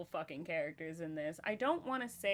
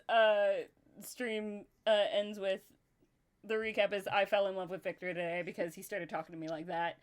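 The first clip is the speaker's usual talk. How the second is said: ordinary talk, nothing marked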